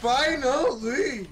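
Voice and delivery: drawn-out exclamation. Pretend. Echoes teammate's line in game.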